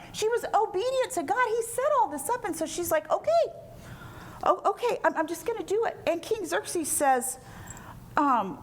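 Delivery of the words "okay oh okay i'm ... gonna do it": high, hesitant mock agreement; acted jittery self-encouragement; nervous resolve. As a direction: talk yourself into it, pitched up and faltering. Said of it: "have fear, but she's come this far".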